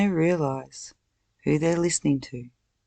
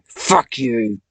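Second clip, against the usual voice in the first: loud, snarled curse; furious shout; 7 dB louder than usual. Real, not acted. Cursing Satan out of her head, furious shout. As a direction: spit it out in rage.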